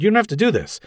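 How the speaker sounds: high, anxious rambling protest; nervous babbling, pitched well up; flustered Gordon. A narrator's reading. Flustered Gordon tries to dissuade Batman.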